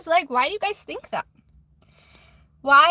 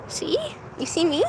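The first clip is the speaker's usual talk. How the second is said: normal talking voice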